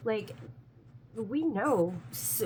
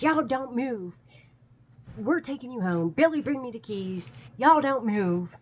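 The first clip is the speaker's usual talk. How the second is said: loud, raised call across distance; acted shouted orders; 5 semitones higher, 6 dB louder than usual. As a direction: holler commands, building louder. Acted man shouting orders from afar.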